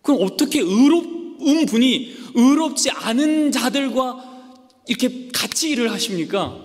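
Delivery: loud, high, slowing down; the prophet's outraged challenge, loud and slow; indignant question. Acted. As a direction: demand an answer from God. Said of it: "라고 물어보는 거예요".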